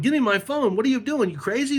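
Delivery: high, urgent retold protest; agitated demand. Chasing the woman who grabbed his phone, agitated demand.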